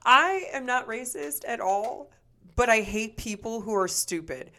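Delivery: ordinary delivery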